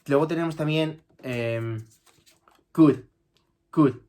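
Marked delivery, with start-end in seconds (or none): 2.7-4.0 could said as kud, no L sound; could without the L. Shown as a demonstration. Teacher drills could as kud. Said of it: "la L no la pronuncio".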